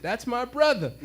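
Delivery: high, loud imitated delight; excited recognition. Aswan vendor claiming his Oakland friend, excited. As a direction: claim him proudly, lifting the voice.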